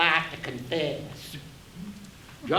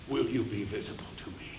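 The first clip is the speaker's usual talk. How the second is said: breathy whispered question; 11 semitones lower, 12 dB quieter, faster than usual. Acted. Narrator asking his conscience in a whisper. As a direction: whisper it, afraid to be heard.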